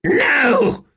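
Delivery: very high, loud shrieked refusal. A narrator's reading. Rulad refusing Hanan Mossag's advice, a loud shriek. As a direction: scream the refusal. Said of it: "he shrieked".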